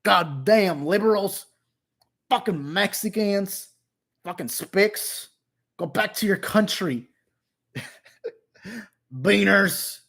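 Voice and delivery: hammed-up American bigot. Streamer mocking a bigot ranting at immigrants, hammed-up American voice. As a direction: put on a strained mock-patriot rant. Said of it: "say it in an American way".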